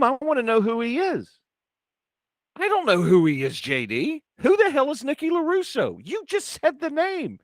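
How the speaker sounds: high-pitched mock exasperation; incredulous back-and-forth, voice climbing high; retold bickering. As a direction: act out both sides of a silly squabble. Acted-out escalating quarrel with friend.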